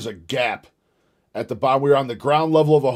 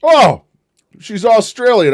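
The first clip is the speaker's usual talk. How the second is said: mock surprise; 10 semitones higher, 11 dB louder, slower than usual. Real, not acted. Mock discovery she's Australian.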